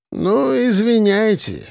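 slow, high-pitched drawl. A narrator's reading. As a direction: stretch out a tipsy apology. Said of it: "протянул Архип". (протяжно, нараспев)

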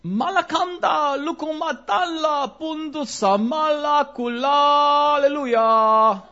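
slow, loud gibberish prayer; loud, slow made-up tongues; mock tongues. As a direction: ham up a burst of tongues.

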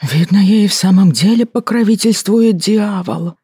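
slow sorrowful lament; sorrowful sigh. A narrator's reading. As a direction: sigh it out in grief. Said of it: "горестно вздыхал преподобный". (горестно вздыхая)

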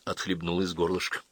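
usual speaking voice

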